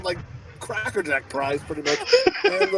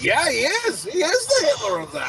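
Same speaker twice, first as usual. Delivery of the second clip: high-pitched imitation of a kid; mock little-boy agreement; younger son; 10 semitones higher than usual. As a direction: chime in eagerly, pitched up like a child. Voices younger son agreeing eagerly.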